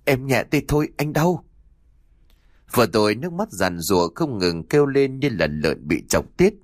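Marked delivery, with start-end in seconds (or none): 0.1-1.4 pained plea. A narrator's reading. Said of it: "kêu á lên một tiếng đau đớn".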